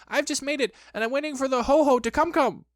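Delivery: high squeaky explanation; agitated little creature; flustered Piglet. A narrator's reading. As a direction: babble it nervously, pitched high. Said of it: "said Piglet huskily".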